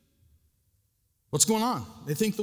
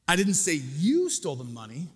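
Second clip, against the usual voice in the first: you lifted with a rising tune; stress on YOU; 4 semitones lower than usual. Shown as a demonstration. Pastor showing shifting emphasis, stress on you. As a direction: lean hard on the word you. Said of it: "the same phrase can mean so many different things".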